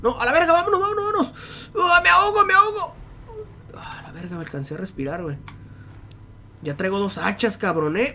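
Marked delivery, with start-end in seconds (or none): 0.0-2.9 high, panicked cries of running out of air; panicked gasping. Real, not acted. Gamer drowning in the game, crying out in panic. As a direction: gasp it out like you're drowning.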